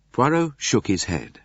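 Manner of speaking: ordinary delivery, nothing marked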